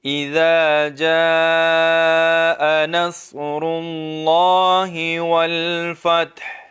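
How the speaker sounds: slow, drawn-out tajweed chant; Quran recitation. Shown as a demonstration. Teacher recites to students in slow melodic tajweed. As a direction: recite it slowly like a qari. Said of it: "tajweed recitation demo".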